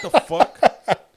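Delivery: loud, high, drawn-out retold outburst; alarmed disbelief. Customer noticing the barber has a razor, alarmed. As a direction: stretch it out in mock alarm.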